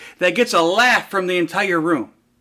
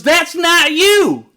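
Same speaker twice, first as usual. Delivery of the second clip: much higher, loud, stretched-out denial; little girl; 10 semitones higher, 5 dB louder, much slower than usual. Retold or imitated. Little girl correcting the guest speaker, high loud insistence. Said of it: "this little girl stands up".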